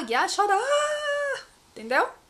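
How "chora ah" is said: high, drawn-out fake bawl; hammed-up wailing "aah", pitched far up; mock crying wail. Shown as a demonstration. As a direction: burst into an exaggerated cry. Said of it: "Agora eu vou chorar".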